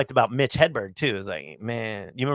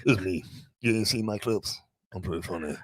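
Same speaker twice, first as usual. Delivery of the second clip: low, soft put-on performer voice; artist voice; 7 semitones lower, 8 dB quieter than usual. Comic tells radio listeners he is funny, artist voice. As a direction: boast low and softly in a put-on voice. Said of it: "that voice is dope".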